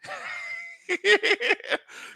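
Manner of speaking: real shrieking laugh. Real, not acted. Loud laugh at own bit.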